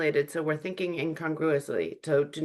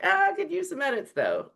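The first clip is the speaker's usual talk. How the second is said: high, playful mock singsong; teasing feedback; 14 semitones higher than usual. Giving feedback with the office inside joke, playful singsong. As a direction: tease a colleague with the inside joke. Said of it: "if you needed to give feedback".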